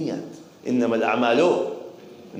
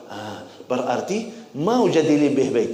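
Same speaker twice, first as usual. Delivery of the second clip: ordinary talk, nothing marked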